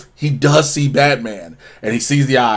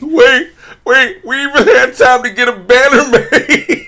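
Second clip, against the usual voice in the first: mock incredulity; 7 semitones higher than usual. Acted astonished Harley at banner.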